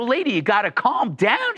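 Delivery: exasperated retort. Retold or imitated. Driver tells screaming woman to settle, exasperated. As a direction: plead for calm over someone's ranting.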